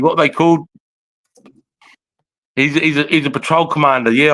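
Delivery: normal talking voice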